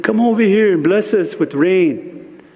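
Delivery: high pleading call. Retold or imitated. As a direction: call the rain clouds to come bless you. Calling the clouds for rain.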